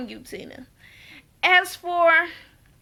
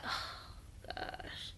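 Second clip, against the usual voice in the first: breathy, voiceless sighing oh gosh; imitating a defeated husband letting it go; exasperated sigh; 24 dB quieter than usual. Husband giving up on Tina, sighing softly. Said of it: "He like".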